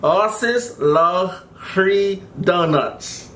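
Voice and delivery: slow, lips stretched wide; mouth guard in. Real, not acted. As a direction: slowly force words past a mouthguard. Reading card through mouth guard.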